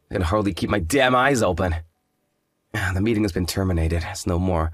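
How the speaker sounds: ordinary delivery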